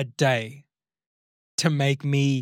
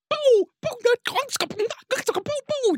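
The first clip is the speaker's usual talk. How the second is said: explosive popping alien gibberish; high-pitched alien mouth noises and pops; imitated alien language. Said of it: "speaking an alien language".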